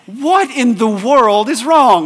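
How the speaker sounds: put-on a parent asking in dismay why the kids are crying; bewildered parent. Parents startled by weeping kids.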